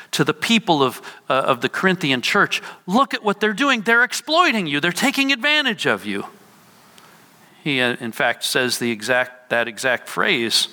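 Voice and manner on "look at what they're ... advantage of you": urgent, higher-pitched exposé of wrongdoing; calling out. Retold or imitated. Preacher as Paul warning the Corinthian church, calling out opponents. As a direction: warn them insistently, voice lifted high. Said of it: "Paul wants to call out these opponents".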